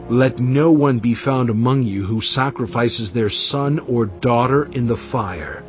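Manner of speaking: ordinary talk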